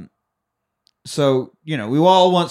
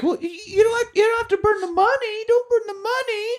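very high squeaky protest; mock whiny Alva. Sister objecting to burning money, high mock whine. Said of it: "stupid shit sister Alva's like".

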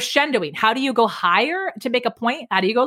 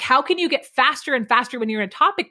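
ordinary talk, nothing marked

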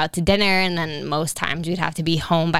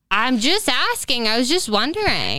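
retold defensive protest; 6 semitones higher than usual. Retelling her reply to boyfriend, high defensive protest. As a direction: protest innocence in a raised, slowed voice.

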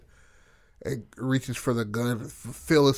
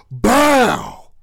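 gunshot; 11 semitones higher, 11 dB louder than usual. Retold or imitated. Gunshot sound effect.

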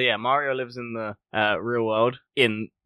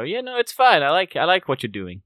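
high, loud cheery voice actor; Mario voice actor impression; 6 semitones higher, 6 dB louder than usual. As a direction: approve it like a cheery old Mario. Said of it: "original voice actor be in that scene and go".

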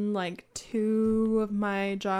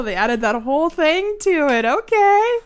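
loud, high mock-impressed delivery; making fun; 5 semitones higher, 8 dB louder than usual. Cohost mocking a company's rebranding, loud and teasing. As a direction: poke fun, rising to a big okay. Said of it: "I'm just making fun of their rebranding".

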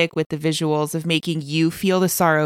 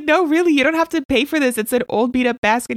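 acted quote; 7 semitones higher, faster than usual. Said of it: "The guy's like".

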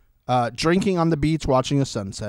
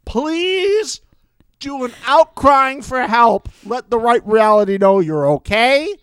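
high, louder hammed-up begging; mock desperate plea; 7 semitones higher, 5 dB louder, slower than usual. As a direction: beg him dramatically, half joking. Mock plea to a show contestant.

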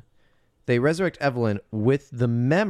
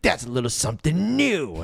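smug quip; 4 semitones higher than usual. Retold or imitated. Quoted quip.

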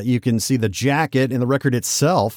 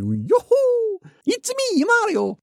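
squeaky, much higher cartoon plumber cry; classic Mario voice; slower than usual. Host imitating Mario's short game catchphrases, cartoonish high. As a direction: chirp it out like the cheerful game Mario. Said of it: "Mario has historically not actually said very much".

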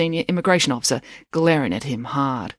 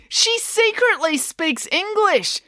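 high-pitched, drawn-out protest; outraged accusation; much slower than usual. A narrator's reading. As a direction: expose her, slowly and shrilly.

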